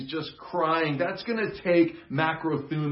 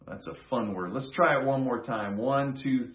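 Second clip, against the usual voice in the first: usual speaking voice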